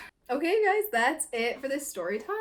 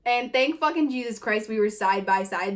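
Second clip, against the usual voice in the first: ordinary delivery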